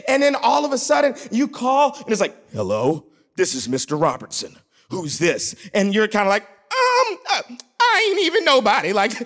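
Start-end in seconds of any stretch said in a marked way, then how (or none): 2.5-5.5 low, formal father answering; deep phone greeting from an intimidating dad; stern dad. Acted. Dad answering the landline to daughter's caller, stern. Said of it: "a dad didn't answer the phone". 6.4-8.9 high, stammering mock panic; nervous caller. Boy caught by girl's dad on phone, nervous.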